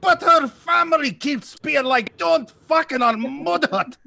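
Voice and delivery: high, slow, hammed-up Scottish brogue; a drawn-out comic Scottish accent, pitched high. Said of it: "That's the best Scottish accent".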